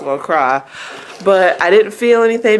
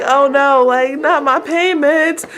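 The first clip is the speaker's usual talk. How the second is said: high, drawn-out mock protest; imitated high-pitched "oh no"; mock dismay; 8 semitones higher, slower than usual. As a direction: wail it lightly in dismay. Retelling dismay as epidural stops.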